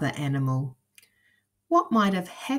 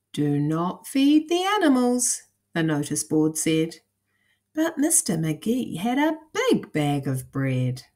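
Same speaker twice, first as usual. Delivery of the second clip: put-on lively storybook narration for kids; reading to children; 3 semitones higher, 4 dB louder, slower than usual. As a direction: read it like a bedtime picture book. Reads picture book to children.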